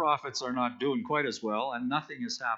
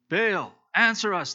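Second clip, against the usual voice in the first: slow, louder call of "Baal, answer us," pitched higher; shouting to Baal; 5 semitones higher, 5 dB louder than usual. Acted. Prophets crying out to their silent god, slow and loud. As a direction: cry out "Baal, answer us," slowly and loud, pleading. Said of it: "they shouted".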